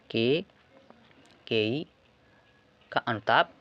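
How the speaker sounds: "Kaikeyi" drawn out very slowly, then a pause before "का अनुताप"; slow dictation. Shown as a demonstration. Repeats title slowly for writing.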